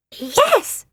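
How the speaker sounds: very high squeaky yes; high-pitched creature's yes; Phoebe's voice. A narrator's reading. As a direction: chirp it high like a small odd creature. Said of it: "said Phoebe".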